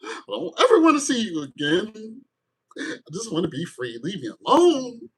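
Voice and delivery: high hammed-up breakup cry; heartbroken Capricorn. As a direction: wail it like a soap opera exit. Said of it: "Capricorn says".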